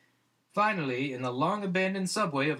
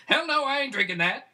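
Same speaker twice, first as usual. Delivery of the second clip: loud, high, flat-out no; defiant refusal; 9 semitones higher, 5 dB louder than usual. Acted. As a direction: shout it like the cup is poison. Monkey refuses the witch's potion.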